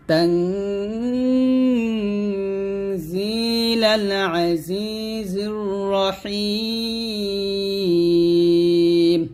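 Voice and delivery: very slow drawn-out chant; tajweed recitation. Shown as a demonstration. Teacher showing students how to recite it. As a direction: draw every syllable out long. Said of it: "এরকম".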